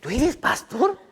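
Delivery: high, laughing "you're a pastor?"; mock shocked stranger, laughing; surprised disbelief. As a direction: act amazed with a laugh.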